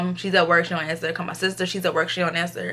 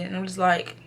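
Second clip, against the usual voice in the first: ordinary talk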